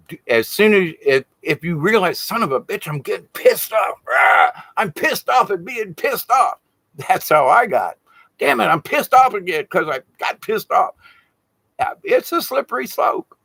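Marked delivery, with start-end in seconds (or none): 2.2-6.6 higher-pitched self-directed griping; acted-out fed-up outburst; mock exasperation. Acting out realizing he is angry. 8.4-10.9 higher-pitched comic self-griping; playacted fed-up complaint; mock exasperation. Acting out anger at being angry.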